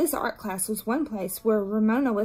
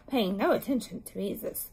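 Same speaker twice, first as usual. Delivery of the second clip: usual speaking voice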